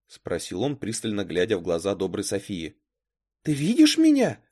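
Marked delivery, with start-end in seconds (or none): 3.4-4.4 put-on high-pitched, louder girl's question; добрая София. A narrator's reading. Girl in the mirror asks what is happening, high voice. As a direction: call out in a high character voice. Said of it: "спросила добрая София".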